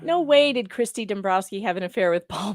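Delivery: normal talking voice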